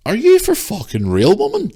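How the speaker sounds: high-pitched, incredulous challenge; indignant retort. Retold or imitated. Shopper challenging a woman in the store, indignant retort. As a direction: throw it at her in disbelief.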